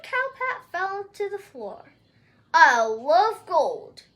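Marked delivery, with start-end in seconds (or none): 2.5-4.0 low, slow, booming giant voice; giant's shout. Acted. Giant bragging about his gold, slow booming shout. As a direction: boom it out like a huge giant. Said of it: "the giant shouted".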